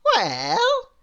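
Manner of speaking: very high, slow sing-song voice; cheerfully refuse, very high; contrary Miss Contrary. Acted. Miss Contrary answers Mister Small, very high sing-song. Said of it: "she said".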